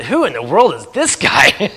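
slow, drawn-out amazed question; amazed wonder. Retold or imitated. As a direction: stretch it out in disbelief. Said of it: "I thought".

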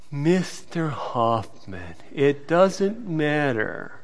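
slow, low and very soft, eye-rolling judge; imitated exasperated judge. Judge rolling his eyes at Mister Hoffman, exasperated. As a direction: spell it out slowly, with hushed, strained patience.

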